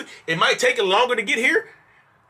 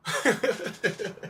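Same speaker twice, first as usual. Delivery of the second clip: soft laugh; 2 semitones higher, 9 dB quieter than usual. Real, not acted. Laughs at own joke.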